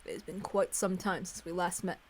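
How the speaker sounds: put-on measured elderly head servant greeting; composed old butler voice; calm composed old servant. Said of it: "greeted me with a calm, composed voice".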